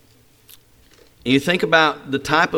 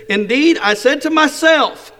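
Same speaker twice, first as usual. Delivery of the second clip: indignant Naaman; 10 semitones higher, 4 dB louder than usual. Acted. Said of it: "what Naaman said".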